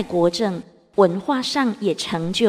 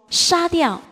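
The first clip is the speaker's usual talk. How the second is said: high-pitched, emphatic narrated call; loud exclamation; 9 semitones higher, 3 dB louder than usual. A narrator's reading. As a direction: call it out like an absorbed chess player. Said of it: "便大声说". (高声喊出棋步)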